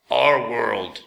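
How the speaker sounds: grinding machine voice; a talking sweeper, metallic crunch. A narrator's reading. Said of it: "heard something say with a grinding crunch".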